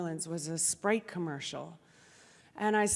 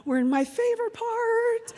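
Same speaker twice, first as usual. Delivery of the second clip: high sing-out reveal with a gleeful "ha ha"; more and more excited; 12 semitones higher, slower than usual. Retold or imitated. Daughter reaching her favorite part of the plane, more and more excited. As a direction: build to a giddy, high-pitched reveal. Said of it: "getting more and more excited".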